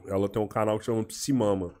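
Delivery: normal talking voice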